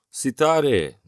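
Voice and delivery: loud, high call of the name Sitare; shout the name. A narrator's reading. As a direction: call out to her across the dark. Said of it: "diye bağırdım". (adını yüksek sesle bağırarak)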